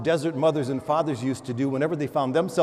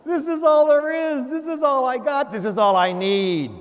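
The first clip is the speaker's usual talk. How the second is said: loud, high impassioned preaching; fiery homily opening; preaching at full force; 11 semitones higher, 5 dB louder than usual. Acted. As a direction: proclaim it to the whole church.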